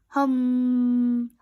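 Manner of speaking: loud flat mechanical drone; robot snore hum. A narrator's reading. Robot snoring while Rabbit shows the bat, mechanical hum. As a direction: drone it like a machine asleep. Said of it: "snored Robot".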